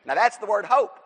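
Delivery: ordinary delivery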